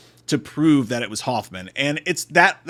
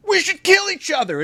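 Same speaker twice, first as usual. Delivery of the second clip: high-pitched dim-witted enthusiasm; mock eager cry; 4 dB louder than usual. Mocks dim characters' eager reply.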